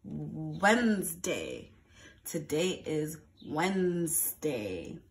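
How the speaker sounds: drawn out teacher voice; slow and drawn out. Shown as a demonstration. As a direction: stretch Wednesday out slowly. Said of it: "What sound does the W make".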